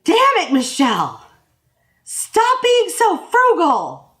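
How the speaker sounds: loud, high mock outburst, slow on the last words; husband yelling at Michelle over spending, loud and high; retold shout. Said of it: "And he goes".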